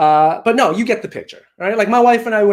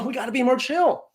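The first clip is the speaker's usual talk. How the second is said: ordinary talk, nothing marked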